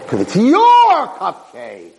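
"your" stretched long, high and loud; "your" drawn out in a high mock yell; stress on YOUR. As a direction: blow up the word "your". Wife's pointed answer, retold.

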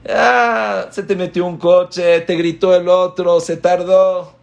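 mock irritated outcry; exasperated outburst. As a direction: blurt out the small annoyances. Acting petty irritations.

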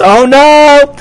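high, loud, panicky cry; screamed plea for help. A narrator's reading. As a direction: call out in alarm as if trapped. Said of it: "he screamed".

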